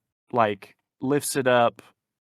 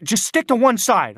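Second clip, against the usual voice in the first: loud, high mock-screamed order; imitated screaming; 10 semitones higher, 6 dB louder than usual. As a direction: mimic a coworker yelling an order, pitched up. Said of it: "'cause she's screaming at me".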